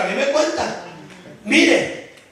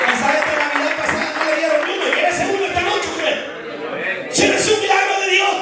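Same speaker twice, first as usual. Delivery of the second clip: fiery preaching; 6 semitones higher than usual. Acted. Preacher urging the congregation to take a miracle, fiery. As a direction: drive it home like a revival preacher.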